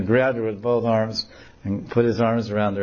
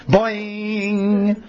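high comic boing; cartoon boing sound effect; springy boing; 11 semitones higher than usual. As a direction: make the branch spring. Branch springs back sound.

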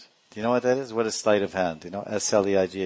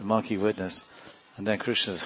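ordinary talk

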